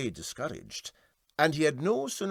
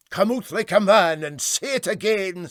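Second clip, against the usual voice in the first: challenging; 9 semitones higher, 11 dB louder than usual. A narrator's reading. Captain challenges the bird.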